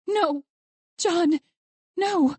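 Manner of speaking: high cries of anguish; anguished, high-pitched outbursts; horrified grief. A narrator's reading. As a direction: cry out for your husband in horror. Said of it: "Horror and grief gripped at her chest".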